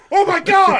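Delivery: a sudden very high, very loud cry of joy; excited shriek. Retold or imitated. Wife cheering a correctly guessed fart, shrieking with joy. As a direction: shriek it out, thrilled she guessed right. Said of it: "she goes".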